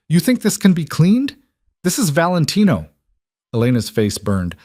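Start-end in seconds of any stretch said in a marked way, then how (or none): 0.1-2.9 higher, louder indignant rich woman; rising outrage. Acted. The soaked woman berates Elena, raised and indignant. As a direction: let the voice climb with offense. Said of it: "The woman's voice rose".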